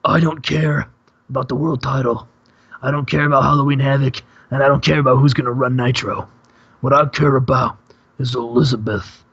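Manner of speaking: a hint of a gravel-voiced wrestling star; Randy Savage voice. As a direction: channel the Macho Man cutting a promo. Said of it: "cuts him off and says".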